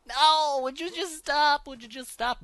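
very high, loud plea; high yelped pleading; desperate shriek. Real, not acted. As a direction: cry out in desperate frustration. Pleading with fleeing raptor.

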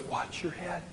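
soft, near-whispered warning; murmured heads-up; soft reminder. Retold or imitated. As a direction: flight attendant quietly reminding him at the door. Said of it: "She goes".

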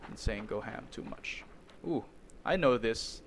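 ordinary talk, nothing marked

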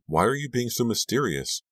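high-pitched demanding question. A narrator's reading. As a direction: press a secretive friend for answers. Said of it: "I demanded".

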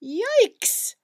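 high, loud startled cry; startled yelp. Acted. Cat yelps at a shape in the closet, startled. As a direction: jump with fright.

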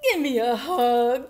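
loud, pleading cry for the bear; narrator's loud call as the little bear. A narrator's reading. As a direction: cry it out loudly. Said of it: "cried Douglas".

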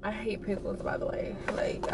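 hushed confiding aside; whispered aside. Real, not acted. Whispered aside about pickles.

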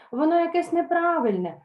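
put-on high, indignant old mares; outraged old mare. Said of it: "обурено сказали старі кобили".

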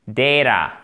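Data as "DAY-da", first syllable strong, then falling; stress on first vowel. Shown as a demonstration. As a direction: lean on the opening vowel of Data, tap the t. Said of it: "Accent tonique sur le première voyelle".

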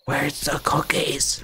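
slow, sneaky whisper; creepy whisper. Pretend. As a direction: whisper it like a lurking pufferfish. Sneaking up for jump scare.

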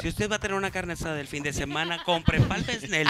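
low, soft seductive ad read; femme fatale. Shown as a demonstration. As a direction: sell it like a seductress. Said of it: "Así, con voz sensual". (anuncio con voz seductora)

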